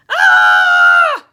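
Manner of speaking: long, very high, very loud scream; sustained shriek of alarm. A narrator's reading. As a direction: scream in full terror at a monster. Toys scream at the shark.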